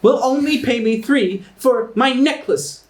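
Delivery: high-pitched indignant complaint; Astra. Acted. Offended seller complaining about the goblin's price, high and indignant. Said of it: "she points at the goblin".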